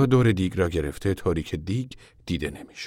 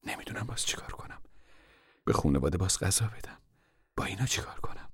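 weary despair; 9 dB quieter than usual. Acted. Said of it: "مادر ناامیدانه و خسته دل گفت".

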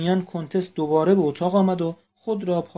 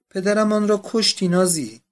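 higher, louder accusation; accusing cry; 5 semitones higher, 6 dB louder than usual. A narrator's reading. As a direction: hurl the blame at her sister. Accusing sister over fainted father.

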